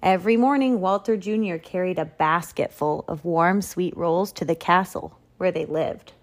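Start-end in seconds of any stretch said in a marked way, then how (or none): none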